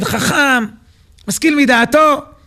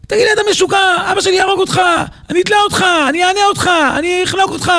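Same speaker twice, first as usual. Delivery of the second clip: mock yelling; 7 semitones higher, faster than usual. Beaten prince threatens to kill his teacher, mock yelling. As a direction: rattle off threats in a raised, shouty pitch. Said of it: "צועק".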